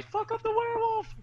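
high, loud battle yell. Acted. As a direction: shout it across the fight. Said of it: "I'm gonna yell at Callie".

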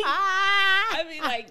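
high, loud words lost in laughter; cracking up. Real, not acted. Storyteller laughing with friend, helpless high laughter. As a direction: wail it slowly through real laughing.

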